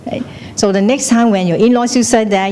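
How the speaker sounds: ordinary talk, nothing marked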